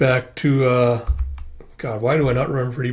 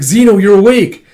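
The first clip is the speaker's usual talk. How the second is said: high, loud exclamation; acted excited greeting; excited relief; 8 semitones higher, 10 dB louder than usual. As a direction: cry out in happy surprise. Relief as friend wakes.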